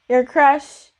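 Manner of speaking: ordinary talk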